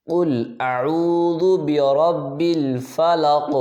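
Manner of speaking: slow melodic tajweed chanting; Quran recitation. Shown as a demonstration. Teacher reciting a verse for students to repeat, melodic. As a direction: recite it slowly in a chanting tune.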